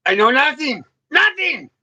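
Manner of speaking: high, insistent I know nothing, rising into a squeak; Sergeant Schultz quote. Acted. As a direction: play the flustered Hogan's Heroes guard.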